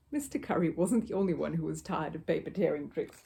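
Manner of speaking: usual speaking voice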